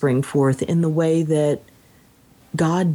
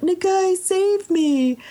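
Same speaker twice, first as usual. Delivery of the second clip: high, strained cry for help; desperate plea; 14 semitones higher, slower than usual. Acted. Warrior mauled by a leopard, crying out to Nagai, high desperate plea. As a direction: call out to the god as the leopard strikes.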